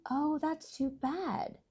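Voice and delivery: the mother gently regrets a broken bell; mother's pity. A narrator's reading. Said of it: "my mother said".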